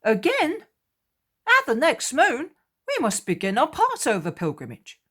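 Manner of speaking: usual speaking voice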